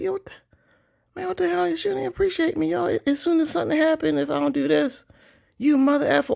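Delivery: high, fed-up mock outburst; frustrated husband. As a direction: act out a husband who feels unappreciated. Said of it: "and you're like".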